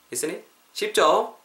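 ordinary talk

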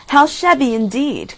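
eager cry. A narrator's reading. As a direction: echo the mayor with eager zeal. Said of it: "cried the town councillors".

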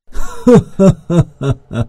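put-on a high, slow old man's chuckle; old man laugh. A narrator's reading. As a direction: laugh like a pleased country elder. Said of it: "노인이 웃었다". (노인의 느긋한 웃음)

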